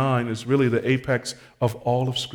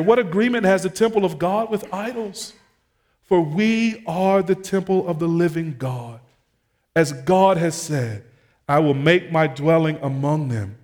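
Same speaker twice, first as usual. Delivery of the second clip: higher, louder pulpit voice; preacher pressing the question on the congregation; impassioned scripture reading; 6 semitones higher, 6 dB louder than usual. Real, not acted. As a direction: proclaim the verse with force. Preacher reads scripture aloud.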